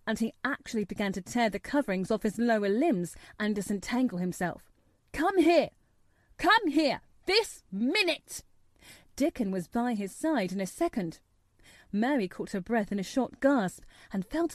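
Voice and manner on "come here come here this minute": high, loud, insistent orders; shouted command. A narrator's reading. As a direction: shout each order separately, demanding obedience. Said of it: "he shouted to Dickon".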